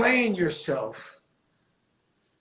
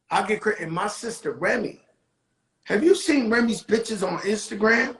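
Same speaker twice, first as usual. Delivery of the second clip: normal talking voice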